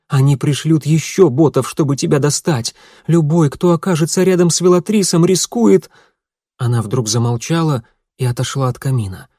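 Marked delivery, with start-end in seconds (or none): 0.1-5.9 higher, louder frightened voice. A narrator's reading. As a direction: rush it out in fear. Jadwiga warns in fear. (испуганная речь)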